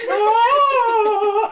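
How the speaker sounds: high, loud laughing sob; crying wail. Retold or imitated. Fan overcome at the concert. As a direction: wail as if about to cry. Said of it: "I'm about to cry".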